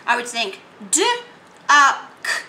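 "duck" drawn out very slowly; slow stretched word. Shown as a demonstration. Teacher modelling thinking a word. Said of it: "I want to do duck".

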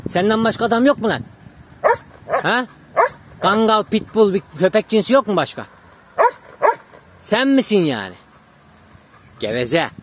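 loud, high rhetorical questions; scolding the dog. Real, not acted. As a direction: tell off a show-off pet. Scolding his attention-seeking dog.

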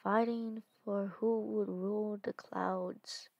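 soft, hushed storytelling voice; story narration. A narrator's reading. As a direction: read it like a dark tale. Dark story narration read aloud.

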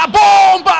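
loud burst on the shot; excited shout. Acted. Thunderous shot, called out.

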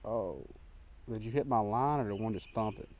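ordinary talk, nothing marked

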